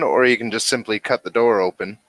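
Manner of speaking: ordinary talk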